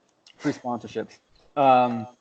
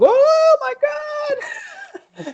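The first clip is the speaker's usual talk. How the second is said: mock mad shriek; much slower than usual. Retelling how mad she was, mock outraged shriek. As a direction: imitate an indignant woman at full squeal. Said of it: "She was so mad".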